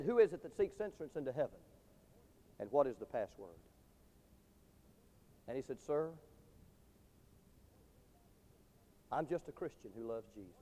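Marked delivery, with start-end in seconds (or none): 0.0-3.6 low, soft challenge from within the gate; hushed gatekeeper question. Acted. Gatekeeper asks the last, humble man. 5.8-10.5 very soft, low, humble answer; humble hushed reply. Acted. Humble believer answers at the gate.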